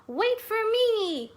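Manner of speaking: high, slow little mouse voice; small mouse calling to her friend. Acted. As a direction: call out as a tiny eager mouse. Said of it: "said Mouse".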